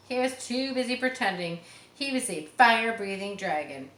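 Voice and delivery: plain reading voice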